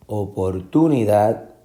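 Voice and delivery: oportunidad stressed inside, on TU; misplaced inner stress. Shown as a demonstration. Teacher repeating options, opor-TU-nidad. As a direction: lift TU in oportunidad. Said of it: "la fuerza de la pronunciación".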